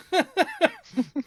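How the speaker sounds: bursts out laughing high; a real laugh breaking out; high burst of laughter. Real, not acted. Mom laughing about flying with a one-year-old, high burst of laughter.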